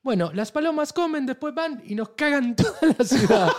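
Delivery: put-on high, loud Italian accent breaking into laughter; mock Italian accent. Venetian man scolds tourist about pigeons, hammed-up Italian accent. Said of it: "Este es mi acento italiano".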